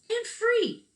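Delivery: high, loud excited "free"; excited emphasis. Acted. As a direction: sell the word "free" with delight. Emphatic punchline of sign.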